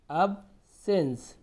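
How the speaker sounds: अब sense slowly as "ab - sens"; broken into parts. Shown as a demonstration. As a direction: clear pause between अब and sense. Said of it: "तोड़ तोड़ के पढ़िए".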